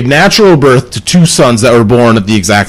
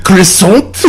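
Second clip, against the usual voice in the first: mock French accent; 5 semitones higher than usual. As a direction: offer it in a hammed-up French accent. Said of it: "Let's hear French".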